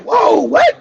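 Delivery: high, startled whoa; a touch of mock alarm; shocked whoa. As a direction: jump up in pitch, caught off guard. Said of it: "I'm like".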